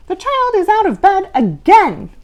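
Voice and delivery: high, loud eager answer; chirpy. Acted. Red monster answering Mister Z, high and chirpy. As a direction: answer like a keen pupil. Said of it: "chirped the red monster".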